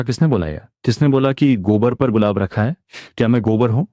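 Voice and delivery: plain reading voice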